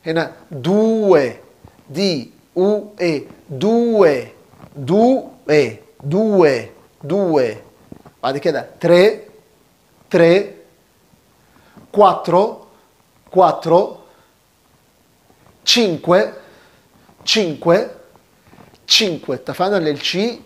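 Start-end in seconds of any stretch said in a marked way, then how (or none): 0.1-7.4 دو drawn out slow and louder as due, doo-eh; Italian due. Shown as a demonstration. A teacher drills the Italian due, slow and emphatic. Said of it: "كل واحد بيقول نطقه الجميل". 8.2-19.4 loud, clear model of تري، كواترو، تشينكوي; Italian numbers drill. Shown as a demonstration. A teacher drills Italian numbers aloud, louder. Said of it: "بيتقال".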